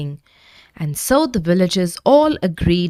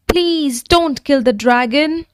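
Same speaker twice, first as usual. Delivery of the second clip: higher pleading plea; begging; 7 semitones higher than usual. A narrator's reading. Jake begging the knight to spare the dragon. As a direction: beg the knight to spare him. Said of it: "begged Jake".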